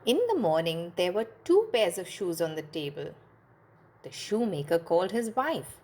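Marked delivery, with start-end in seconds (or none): none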